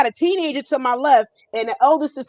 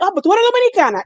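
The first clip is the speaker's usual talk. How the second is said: surprised question; 8 semitones higher, much faster than usual. Retold or imitated. Clerk quizzing her, surprised.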